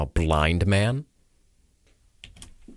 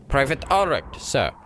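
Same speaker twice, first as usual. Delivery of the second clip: high name-and-rank reply; soldier reporting; 8 semitones higher than usual. Acted. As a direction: pitch the name up, drop on sir. The second soldier responded with.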